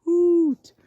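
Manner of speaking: high, loud, drawn-out hoots; owl hooting. Acted. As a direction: hoot like a singing owl. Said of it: "He says".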